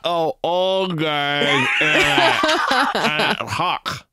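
talking with jaw locked open; jaw stuck open. Acted. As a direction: mouth stuck open, words mangled. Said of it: "It's hard to say it 'cause my jaw is stuck".

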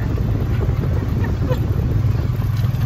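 breathless high-pitched laughter; loud high laugh. Real, not acted. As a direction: burst out laughing. Laughing at fast driving.

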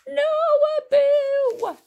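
very high, loud, drawn-out yell; panicked high shout; shouted warning. A narrator's reading. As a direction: scream it to stop him. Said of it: "shouted Aladdin".

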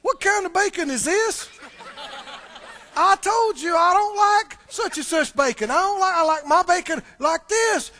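hammed-up grumpy husband at the table; mock angry complaint. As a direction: play a picky grouch yelling about breakfast. Acting out picky grumbler.

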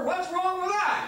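high, drawn-out mocking whine. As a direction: whine the catchphrase up high and slow. Repeated mocking catchphrase.

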